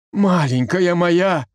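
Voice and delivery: reach out to her gently; tender. A narrator's reading. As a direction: Kolka kneels before his frightened wife. Kolka kneels to wife.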